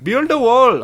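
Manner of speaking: loud high taunt; heckling taunt. Retold or imitated. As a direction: throw the line at him.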